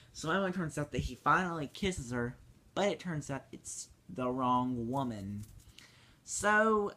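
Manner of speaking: ordinary delivery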